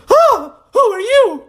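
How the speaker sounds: very high, loud squawk; hammed-up alarmed falsetto; startled strangers. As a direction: squeal it like a shocked neighbour. Startled strangers behind hedge.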